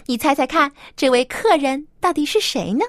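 plain reading voice

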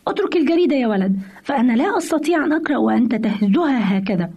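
ordinary delivery

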